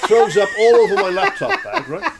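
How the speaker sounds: loud, high, laughing punchline; laughing hard. Real, not acted. Comedian telling of sick on the laptop bag, laughing. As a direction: crack up while telling it.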